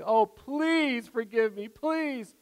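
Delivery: slow, high whiny plea; mock child pleading. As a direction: stretch it out like a begging kid. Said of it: "pound and say".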